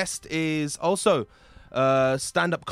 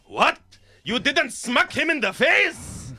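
loud, high-pitched mock outrage; outraged strict dad; 11 semitones higher, 7 dB louder, slower than usual. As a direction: yell like an appalled dad, stretching it out. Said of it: "Not the dad who's like".

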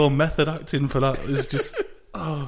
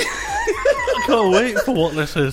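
laughing; 7 semitones higher, slower than usual. Real, not acted. Both laughing.

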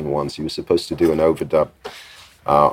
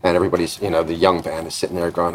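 usual speaking voice

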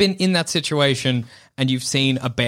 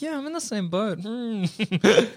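mock unsure friend; 7 semitones higher than usual. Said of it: "you can be like".

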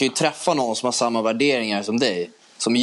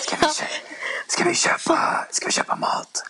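whisper. Pretend. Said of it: "viskar högt".

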